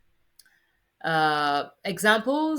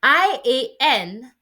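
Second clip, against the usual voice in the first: usual speaking voice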